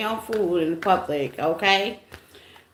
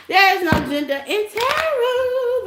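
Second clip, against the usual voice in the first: sassy; 12 semitones higher than usual. Real, not acted. Sassy declaration to chat.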